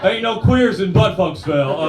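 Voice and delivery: slow, loud mock small-towner saying "queers" with a rise; hammed-up local. Comic bit mocking Midwestern locals, slow loud mock voice. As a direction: drag it out like a proud local. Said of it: "people are like".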